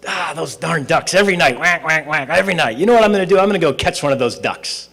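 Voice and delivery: a fed-up bum ranting about noisy ducks; grumpy vagrant. Acted. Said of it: "one of the guys perks up and goes".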